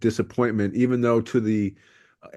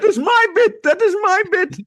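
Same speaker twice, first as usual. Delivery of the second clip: very high, squeaky whining voice; squeaky mock voice; 7 dB louder than usual. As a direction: squeal it like a petty rival. Mocking Gabriel's protest.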